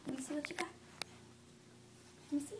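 put-on playful whisper to a toddler. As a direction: whisper it like a secret. Repeated as a whisper to child.

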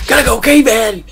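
high, loud mock-excited yell; hammed-up caving war cry; over-enthusiastic shout. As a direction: shout it with huge fake enthusiasm. Answers remark with mock enthusiasm.

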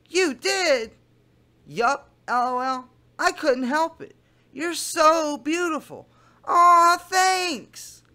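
lightly voiced teen girl gushing; Kayla voice. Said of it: "I'm doing my Kayla voice".

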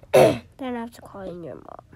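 loud, fast stern warning; loud stern threat. Pretend. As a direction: bark the threat. Principal threatens.

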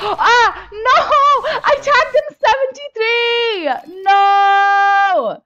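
very high, very loud scream of protest; anguished shout. Real, not acted. Gamer who just lost a close fight, anguished shouting. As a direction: scream it out in real frustration.

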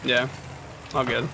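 ordinary talk

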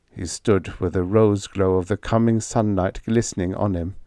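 usual speaking voice

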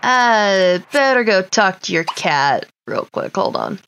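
ordinary talk

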